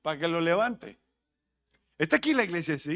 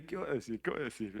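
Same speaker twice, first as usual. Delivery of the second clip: low, soft mumbled quote; quiet aside in the congregation's voice; imitated listeners' murmur; 5 semitones lower, 10 dB quieter, much faster than usual. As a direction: mimic people wondering to themselves, much softer and lower. Imitates people waiting for joke.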